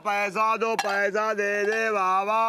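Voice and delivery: high, drawn-out beggar's call; loud begging cry. Acted. Beggar pestering people for money, loud singsong cry. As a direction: wail for coins at full volume. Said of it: "थोड़ा धीरे बोलो".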